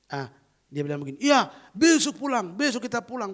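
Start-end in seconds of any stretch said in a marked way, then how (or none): 1.2-3.3 patient eagerly agreeing to go home tomorrow. Retold or imitated. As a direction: say it with happy eagerness. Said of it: "Saking semangatnya".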